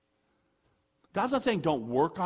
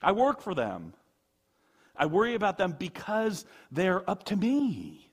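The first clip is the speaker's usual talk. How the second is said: mock protest; 5 semitones higher, 6 dB louder than usual. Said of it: "And you're like".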